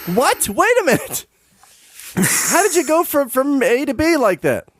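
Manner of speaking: can't believe what he just heard, high-pitched; shocked disbelief. Real, not acted. Host reacts to cohost's outrageous claim, shocked and high.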